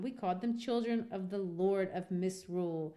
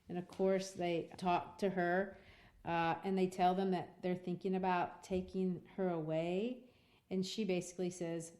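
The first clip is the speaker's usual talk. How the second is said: normal talking voice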